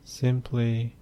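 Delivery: soft, unhurried meditation voice with long pauses; hushed, even delivery, letting phrase ends fade; guided meditation. Acted. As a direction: guide the listener gently into stillness, phrase by phrase.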